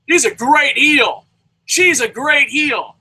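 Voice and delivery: high, loud, drawn-out proclamation; belted mock praise; mock shouted praise. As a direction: stretch each word out high and slow, mock cheering. Mock praise of wrestling heels.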